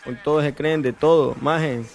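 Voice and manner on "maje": ordinary delivery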